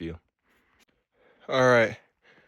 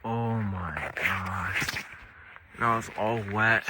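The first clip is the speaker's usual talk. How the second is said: dismayed whisper; 4 semitones lower, slower than usual. Real, not acted. Soaked by wet snow, dismayed.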